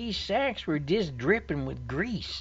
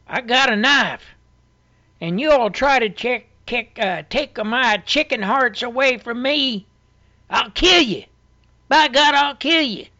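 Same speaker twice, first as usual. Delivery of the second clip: loud, high, furious threat; threatening yell; 5 semitones higher, 10 dB louder than usual. Retold or imitated. Man at the gizzard fight yelling at the crowd, loud and high. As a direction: yell it like he'd stab anyone near his food. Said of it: "I heard him yell".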